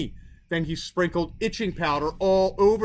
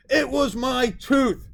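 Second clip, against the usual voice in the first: put-on upset child; 7 semitones higher, 4 dB louder, slower than usual. A narrator's reading. Child upset about lost tooth.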